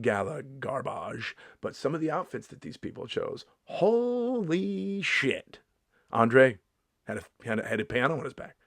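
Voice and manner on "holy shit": drawn-out high "holy shit"; slow amazed gasp of disbelief; shocked. Real, not acted. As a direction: stretch it out, stunned. Shocked at Met Gala outfits.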